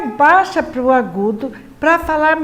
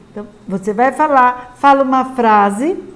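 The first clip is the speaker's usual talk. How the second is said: ordinary talk, nothing marked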